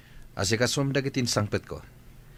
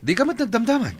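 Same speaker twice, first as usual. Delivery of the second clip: sharp retort; 11 semitones higher, 4 dB louder than usual. Acted. Heated argument between spouses.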